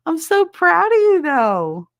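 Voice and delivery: high, loud burst of pride; proud, loud congratulations; gushing pride. Real, not acted. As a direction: cheer them on with a big high swell. Cheering on a viewer's achievement.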